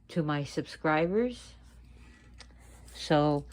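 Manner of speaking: ordinary delivery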